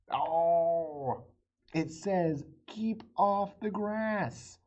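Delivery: slow, loud, high reading of the sign; slow emphatic reveal. Acted. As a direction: read each word out slowly for the kids.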